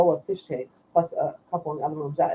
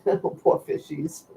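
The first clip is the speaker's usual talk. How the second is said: laughing; 5 dB quieter, much slower than usual. Real, not acted. Laughing about the boiled fish.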